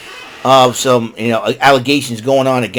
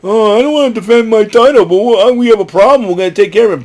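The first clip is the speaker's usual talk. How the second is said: high, whiny crybaby quote; mock whining; 8 semitones higher, 4 dB louder, faster than usual. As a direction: whine it like a sore loser. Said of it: "crying and whining about".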